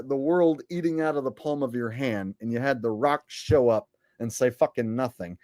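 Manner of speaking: usual speaking voice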